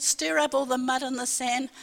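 ordinary talk

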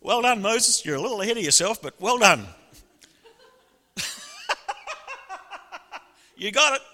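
high, loud, amused God praising Moses; God's voice. Acted.

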